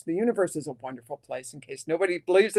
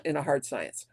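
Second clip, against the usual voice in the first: whispered; 4 semitones lower, 7 dB quieter than usual. Real, not acted. Understated aside about herself.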